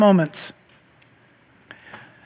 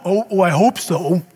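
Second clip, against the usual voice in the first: lower, wavering mock wishful voice; mock wishful hope; 4 semitones lower than usual. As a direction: sound like someone only half hoping. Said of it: "It's not the hope".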